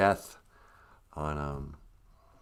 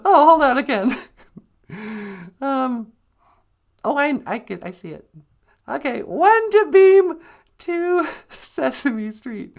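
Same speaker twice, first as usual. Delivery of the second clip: sweetie character; 13 semitones higher, 7 dB louder than usual. Acted. Sweetie retrying the teleporter beam, high put-on voice.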